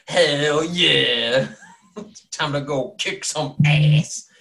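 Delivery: loud, drawn-out rocker cheer; jock-rock hype. Pretend. Game master mocking Anton's fired-up moment, jock-rock hype. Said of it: "jock rock started up and be like".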